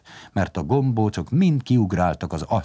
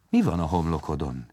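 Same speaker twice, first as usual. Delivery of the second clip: ordinary delivery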